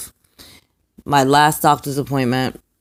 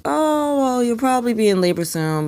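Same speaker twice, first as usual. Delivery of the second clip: higher, airy, dismissive doctor imitation; mocking the doctor; 10 semitones higher than usual. Mother mimics the doctor who doubted her labor, mocking higher voice. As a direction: lightly parody a doctor brushing her off. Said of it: "The doctor's like".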